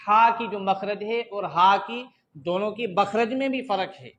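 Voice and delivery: usual speaking voice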